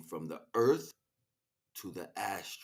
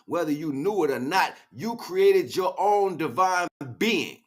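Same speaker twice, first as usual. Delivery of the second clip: loud, building preacher's roar; fiery preaching; 9 semitones higher, 13 dB louder than usual. Acted. Speaker preaching to the listener about his vow, fiery preaching. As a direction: preach it at full force, driving each line home.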